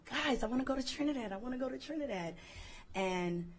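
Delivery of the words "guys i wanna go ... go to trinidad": retold screaming. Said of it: "I've been screaming".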